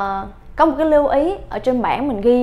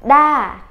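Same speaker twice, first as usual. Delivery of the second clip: "da" said alone, louder and hit hard; isolated, emphatic "da"; stressed syllable; 3 semitones higher, 6 dB louder than usual. Shown as a demonstration. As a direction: punch the syllable "da". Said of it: "lưu ý chỗ cái chữ da nha".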